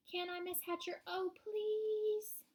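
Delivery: put-on high, pleading girl; begging. A narrator's reading. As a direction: beg eagerly, drawing out the last word. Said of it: "begged some more".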